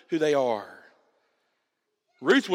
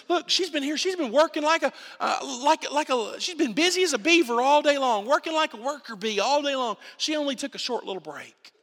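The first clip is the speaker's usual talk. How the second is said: eager foreman; 9 semitones higher than usual. Retold or imitated. Foreman reporting to Boaz.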